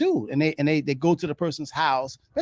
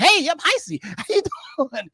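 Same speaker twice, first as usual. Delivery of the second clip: much higher and louder, bubbly hello; too upbeat greeting; 10 semitones higher, 4 dB louder than usual. Shown as a demonstration. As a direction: bounce in with full energy. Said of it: "you don't be like".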